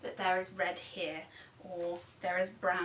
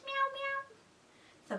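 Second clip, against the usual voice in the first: cat meow. Shown as a demonstration. Animal noise for toddlers.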